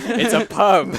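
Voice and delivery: put-on loud, high British accent, laughing; teasing hammed-up Brit offering a translation; mock British. Host correcting her bodega, loud mock British accent. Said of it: "A British person would never know".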